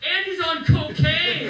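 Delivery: high, loud, drawn-out mock yell; kid yelling. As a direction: call it out slowly like a heckling kid. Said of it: "And so one kid yells".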